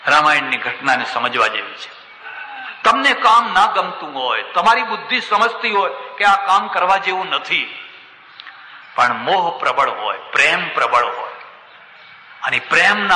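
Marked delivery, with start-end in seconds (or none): none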